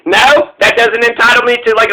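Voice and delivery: ordinary talk, nothing marked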